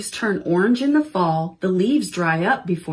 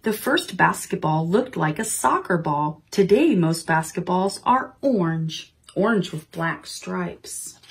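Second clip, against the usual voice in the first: ordinary delivery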